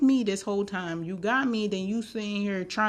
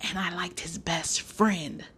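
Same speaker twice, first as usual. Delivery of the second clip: secret whisper; 3 semitones lower, 4 dB quieter, slower than usual. Real, not acted. Confessing a secret crush.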